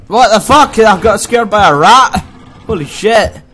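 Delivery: high, loud shocked outburst; startled yelling. Real, not acted. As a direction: jump and yell in shock. Scared by a rat.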